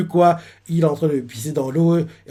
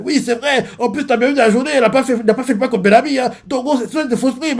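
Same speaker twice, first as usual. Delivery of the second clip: high, quick eager agreement; lightly voiced sidekick; reassured lackey; relieved crewman; 7 semitones higher, faster than usual.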